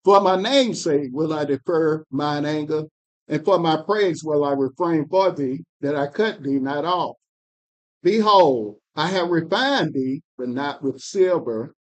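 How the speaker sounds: measured, solemn Bible reading; scripture reading. Acted. Reader to study group, solemn scripture reading. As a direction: read the prophet's words with weight.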